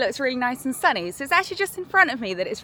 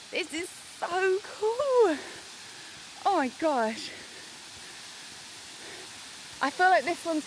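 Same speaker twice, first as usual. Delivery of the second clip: awed; 6 semitones higher than usual. Real, not acted. Awed on seeing waterfall.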